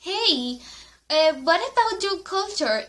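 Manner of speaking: curious friendly question. Retold or imitated. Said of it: "I asked her".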